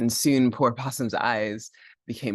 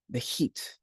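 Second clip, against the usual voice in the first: hushed; 6 dB quieter than usual. A narrator's reading. Feeling the heat.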